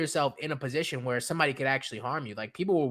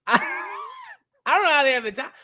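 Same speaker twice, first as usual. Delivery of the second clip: high, louder incredulous repeat; exasperated; 11 semitones higher, 5 dB louder, much faster than usual. Real, not acted. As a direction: throw up your hands at it.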